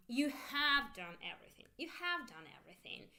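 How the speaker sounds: "have" lifted for emphasis; teacher modelling emphatic HAVE; stress on HAVE. Shown as a demonstration. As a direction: lean on the word "have". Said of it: "You make emphasis with your intonation".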